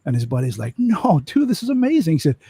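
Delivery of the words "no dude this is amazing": much higher, thrilled; can't believe his luck; amazed buddy. Retold or imitated.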